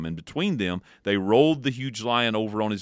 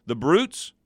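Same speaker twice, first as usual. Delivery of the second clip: higher, louder tearful cry; rising tearful cry; 6 semitones higher, 4 dB louder than usual. A narrator's reading. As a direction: let the grief break out. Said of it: "tears streaming down her cheeks".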